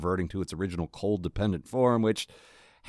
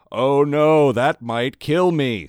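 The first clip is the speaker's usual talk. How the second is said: deadpan mock alarm; 4 semitones higher, 9 dB louder, much slower than usual. A narrator's reading. Sarcastic Victor quote.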